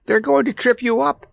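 put-on high, alarmed boyish warning; urgent warning. A narrator's reading. Randy warns Kabumpo of the wire, urgent warning. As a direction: warn your friend in a high, urgent voice. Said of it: "warned Randy".

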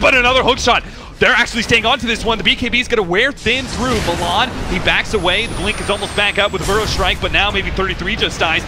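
excited high-pitched chase commentary; live play-by-play. Real, not acted. Caster follows the chase on Milan, excited play-by-play. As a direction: lift the call as the hook lands.

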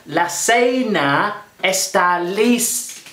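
very slow, drawn-out "La cena está lista"; slow Spanish. Shown as a demonstration. Chef announcing dinner to viewers, slow grand Spanish. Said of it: "For those of you that aren't very good at Spanish".

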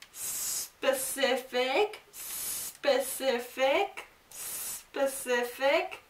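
specific drawn out very slowly, syllable by syllable; slow drill. Shown as a demonstration. Teacher to students, modeling specific slowly for pronunciation. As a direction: stretch specific out slowly for the class. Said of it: "teacher drilling pronunciation slowly".

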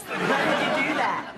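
lips kept still, ventriloquist style; talking with mouth closed. Pretend. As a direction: try to speak without moving lips. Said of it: "you just talk without moving your lips".

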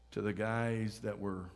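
ordinary talk, nothing marked

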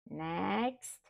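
ordinary talk, nothing marked

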